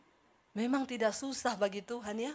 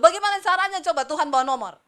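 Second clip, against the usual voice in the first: ordinary delivery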